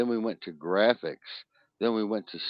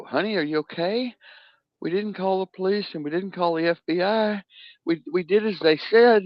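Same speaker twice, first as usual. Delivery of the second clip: much higher, anxious mom voice; worried parent; 11 semitones higher than usual. Retold or imitated. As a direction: play the frantic mother checking her child is safe. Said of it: "You go".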